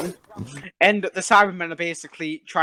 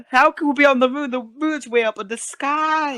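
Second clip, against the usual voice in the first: Jamie impression; 7 semitones higher than usual. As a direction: put on a raised, incredulous character voice.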